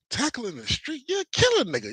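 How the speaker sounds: incredulous. Real, not acted. Incredulous reaction to tackle story.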